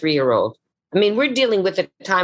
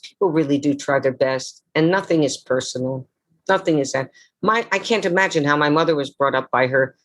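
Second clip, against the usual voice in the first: ordinary talk, nothing marked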